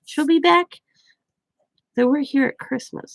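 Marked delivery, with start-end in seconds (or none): 0.1-0.7 put-on very high sing-song voice for a child; retold high-pitched apology to a little one; grandma consoling. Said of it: "I'm like".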